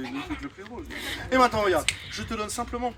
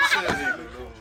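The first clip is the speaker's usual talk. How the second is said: high laughing burst; laughing hard; 10 dB louder, slower than usual. Real, not acted.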